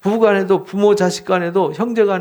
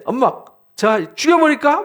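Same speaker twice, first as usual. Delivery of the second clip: high mock complaint to Mom; climbing, hammed-up child's outburst; imitated angry child; 8 semitones higher, 4 dB louder than usual. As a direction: act out a worked-up kid tattling. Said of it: "화가 나서 막 그렇게 하는데".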